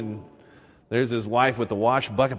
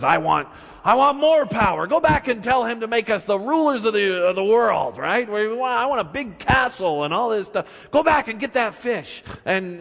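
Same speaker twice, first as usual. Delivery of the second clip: demanding wife; 7 semitones higher than usual. Acted. Greedy wife demands more.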